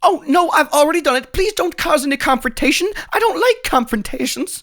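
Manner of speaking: high, whiny, flustered pleading; panicky sheriff. Acted. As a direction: beg them high and nervous to calm down. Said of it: "while the sheriff freaks out like".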